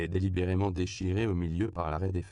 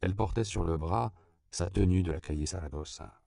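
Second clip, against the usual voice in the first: usual speaking voice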